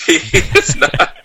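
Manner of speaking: laughing through the words; giggling, high and slow. Real, not acted. Laughing denial.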